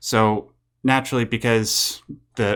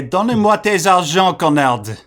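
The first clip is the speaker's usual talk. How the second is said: hammed-up French accent, raised and louder; mock French; 8 semitones higher, 6 dB louder than usual. Cohost jokingly translating the executive into a hammed-up French accent. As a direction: play a greedy Frenchman demanding cash. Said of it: "I'll say it in French for you".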